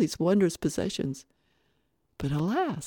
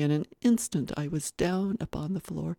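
plain reading voice

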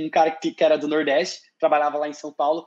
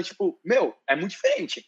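usual speaking voice

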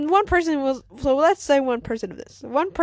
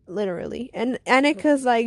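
plain reading voice

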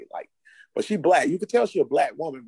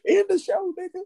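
high, laughing plea. Real, not acted. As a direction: beg through laughter, pitched up. Laughing hard at the joke.

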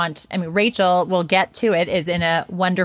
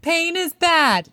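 hammed-up protest, pitched much higher; mock refusal; 10 semitones higher, slower than usual. Host mimics herself rejecting a friend's advice, high mock protest. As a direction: play your past self balking at the advice. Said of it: "And I was like".

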